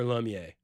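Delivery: "Lumiere" as lum-YAY; short "uh" in "Lumiere", no final R; low, offhand "Lumiere" said lum-yay; Louisiana pronunciation. Shown as a demonstration. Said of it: "we would definitely pronounce that".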